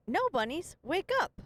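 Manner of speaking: high, bright sing-song wake-up call; kids' song voice. Retold or imitated. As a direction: call it out like a kids' music track. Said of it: "and then it says".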